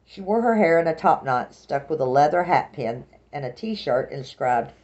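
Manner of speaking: usual speaking voice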